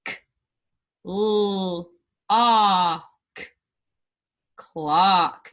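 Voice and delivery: sounds said apart, then quack whole; blending sounds. Shown as a demonstration. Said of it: "blend these words".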